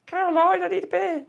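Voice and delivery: high mock call to the wife; mock call to the missus. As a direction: pitch it up like calling upstairs to the missus. Calling to wife who's gone to bed.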